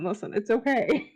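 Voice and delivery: ordinary talk, nothing marked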